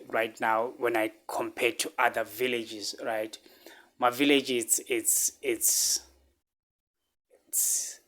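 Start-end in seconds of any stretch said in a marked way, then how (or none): 4.0-8.0 holding back tears. Real, not acted. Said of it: "I don't wanna cry".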